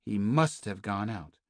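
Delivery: ordinary delivery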